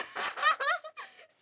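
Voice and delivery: shrieking laughter; loud high laugh. Real, not acted. Bursts out laughing.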